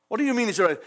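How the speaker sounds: ordinary talk, nothing marked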